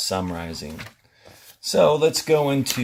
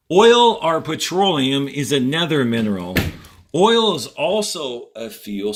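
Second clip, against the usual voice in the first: reading aloud to class; 3 semitones higher, 4 dB louder than usual. Acted. Teacher reading the passage to students, clear and measured. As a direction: read each sentence out for students.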